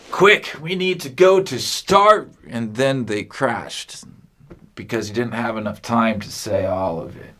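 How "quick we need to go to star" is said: high, loud, cut off mid-word; urgent captain cry. Acted. Captain yells in panic before the crash, cut off. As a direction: panic as the ice looms. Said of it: "And then he says".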